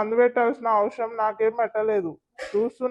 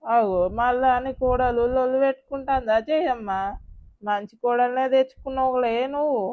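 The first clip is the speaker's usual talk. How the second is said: gossiping villager. Acted. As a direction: needle Jayamma about her daughter-in-law.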